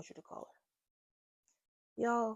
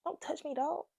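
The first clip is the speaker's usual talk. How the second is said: pitched way up, sharp and annoyed; snapping at him; 10 semitones higher than usual. Retold or imitated. Telling the man in bed to back off, irritated and high. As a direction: shove him off with the words. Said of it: "I'm like".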